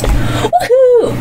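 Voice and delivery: high, laughing whoop of joy; excited cheer. Real, not acted. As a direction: cheer like you just won. Happy reaction to the game.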